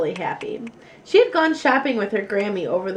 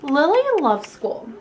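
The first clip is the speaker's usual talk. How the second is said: ordinary delivery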